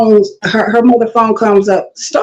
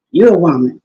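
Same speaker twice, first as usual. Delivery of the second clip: ordinary talk, nothing marked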